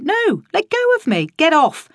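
exasperated yell at a clinging woman; shouting her off. Acted. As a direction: shove her away with your voice. Fending off wife with baby.

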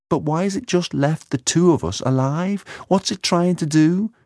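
high, bewildered questioning; distressed Layla. Acted. Layla asking why only two are left alive, distressed.

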